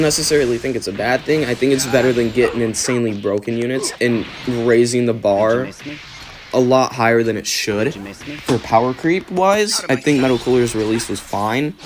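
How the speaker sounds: usual speaking voice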